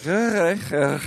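put-on repeating the drunk Glaswegian's high, sing-song "hi"; drunk Scottish fan.

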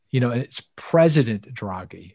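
ordinary talk

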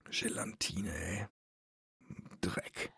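whispered disgust. Real, not acted. Disgusted muttering about candy.

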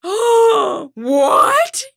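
put-on high, loud shocked kid's voice; alarmed child. Young daughter discovering shot day, high alarmed cry. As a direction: shriek it like a kid who just found out.

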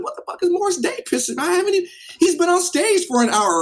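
high-pitched, indignant bafflement; acted-out incredulous objection; baffled protest. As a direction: protest loudly in total disbelief, building in volume.

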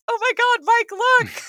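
high-pitched thrilled exclaim; excited squeal. Retold or imitated. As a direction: squeal it like big news just arrived. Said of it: "and I'm like".

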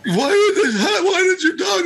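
high-pitched blubbering wail; mock bawling. Imitating the upset owner questioning him, mock bawling. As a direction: blubber it out, exaggerated crying. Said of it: "and he's bawling".

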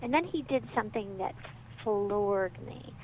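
ordinary delivery

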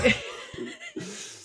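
sudden high peal of laughter; real laugh breaking out; burst of laughter. Real, not acted. Co-host laughs at the phone number joke, a high laugh.

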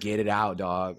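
firmer, out-loud repeat of the order; insistent urging. Retold or imitated. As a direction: press them, no longer hushed. Friend insisting a second time.

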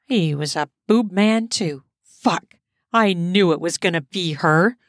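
usual speaking voice

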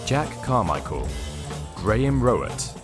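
ordinary talk, nothing marked

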